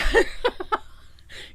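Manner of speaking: high burst of giggling; real laugh, high and breathy. Real, not acted. Vlogger tickled by the reformed snowman, high laugh.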